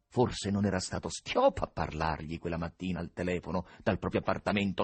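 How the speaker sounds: ordinary delivery, nothing marked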